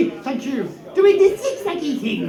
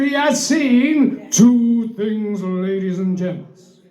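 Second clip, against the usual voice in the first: slow dramatic build; 5 semitones lower, slower than usual. Acted.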